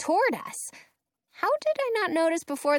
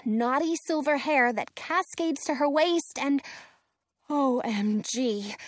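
usual speaking voice

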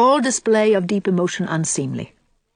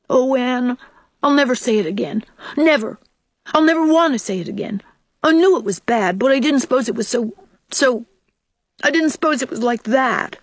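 put-on high, hurried child's repentance; upset small boy pleading forgiveness; distressed remorse; 6 semitones higher than usual. A narrator's reading. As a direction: swear it off urgently, pitch well up. Child's horrified remorse after saying bad word.

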